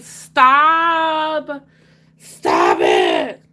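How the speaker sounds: high, hammed-up yells of stop; mock shouted stop. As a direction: yell stop at yourself in mock despair. Mock pleading with herself.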